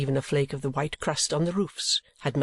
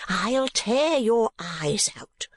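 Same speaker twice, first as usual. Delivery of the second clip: high, drawn-out furious threat; slow high-pitched wifely fury; screamed threat; 8 semitones higher, much slower than usual. A narrator's reading. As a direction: screech slowly at the husband, enraged. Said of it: "screamed the woman".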